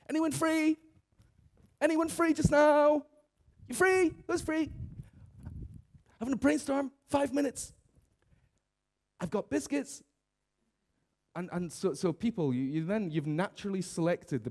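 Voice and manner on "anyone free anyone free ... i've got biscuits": high, loud, sing-song rounding up of helpers; chirpy coworker touting a brainstorm round the office; cheery office colleague. Retold or imitated. Colleague roaming the office recruiting for a brainstorm, high and loud. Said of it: "they come around and they go".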